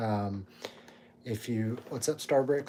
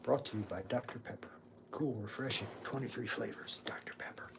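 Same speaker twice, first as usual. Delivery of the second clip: mock ad read; 10 dB quieter than usual. Streamer jokingly reads a sponsor plug, softening to a whisper. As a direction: fake sponsor voiceover, fading to a whisper.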